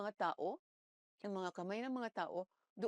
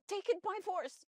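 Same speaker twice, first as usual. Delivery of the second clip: slow, weighty, higher and louder; forceful declaration; 8 semitones higher, 4 dB louder, slower than usual. Acted. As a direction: declare it like a command. Quoting Bible, preaching. (mabagal at mariing pagbigkas)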